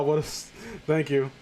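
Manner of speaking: ordinary delivery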